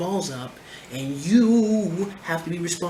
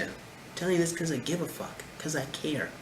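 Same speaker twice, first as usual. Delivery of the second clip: sincere, much softer; 8 dB quieter than usual. Real, not acted. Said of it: "sincere closing".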